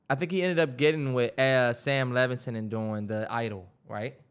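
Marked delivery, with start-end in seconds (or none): none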